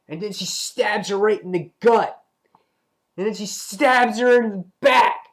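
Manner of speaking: gleeful blow-by-blow, punching the last words; excited escalation. Real, not acted. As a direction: relish each hit, building to a peak. Excitedly retelling a violent climax.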